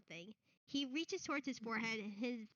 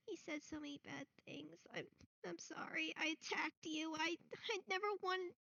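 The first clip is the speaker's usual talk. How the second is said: high, broken apologies; high sobbing boy voice; tearful boy; 6 semitones higher than usual. Acted. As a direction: choke out the sorry. NPC sobs apologies.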